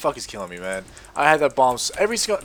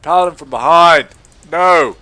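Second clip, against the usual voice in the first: louder panicked yell; alarmed shout; 3 semitones higher, 12 dB louder than usual. Real, not acted. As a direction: cry out as you're hit from behind. Ambushed in game.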